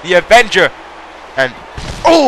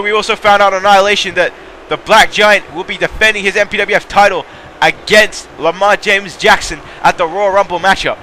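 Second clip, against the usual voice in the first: normal talking voice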